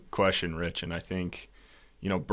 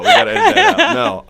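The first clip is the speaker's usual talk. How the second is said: much higher, louder squeal through laughter; mock squeaky panic; 12 dB louder than usual. Repeating his plea to the hosts, mock squeal. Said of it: "should've said, should've said that".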